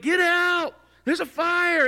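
loud alarm cry to people in a burning building; megaphone alarm shout. Retold or imitated. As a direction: shout the fire warning through a megaphone. Warning through a megaphone.

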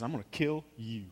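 lightly imitated tough cowboy, low and soft; quiet threat in a mild movie-hero impression; John Wayne. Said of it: "John Wayne says".

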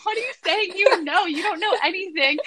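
high-pitched, incredulous snapping at mom; comic outraged daughter; exasperated retort. Retold or imitated. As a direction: protest in disbelief, half laughing by the end. Acted retort to her mom.

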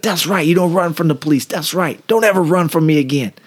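high-pitched mock yell, gloating; retold yell. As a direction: act out a triumphant telling-off without real volume. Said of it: "I'm yelling at him".

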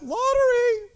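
comic high-pitched punchline; squeaky punchline. Retold or imitated. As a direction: jump way up high on Lottery. Punchline in mock prophet quote.

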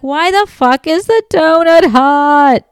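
high, loud, drawn-out acted yell; retold yelling. Wife answering husband about the hot donut, acted yell. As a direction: yell it in high, stretched-out outrage. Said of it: "Why are you yelling?".

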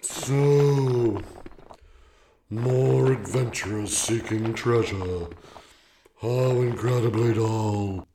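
slow, loud, bored stone creature; gravelly voice. A narrator's reading. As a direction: grind it out like weary rock. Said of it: "spoke in a gravelly voice".

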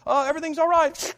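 high, loud hurried brush-off; dad hurriedly telling mom all is fine; quickly reassuring. Retold or imitated. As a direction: rush to calm the mom on the phone. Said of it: "he's like, quickly".

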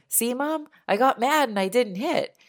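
a small son showing off to mom, pitched high and proud; proud little boy. Retold or imitated. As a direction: play the proud kid telling mom.

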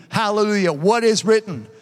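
ordinary delivery

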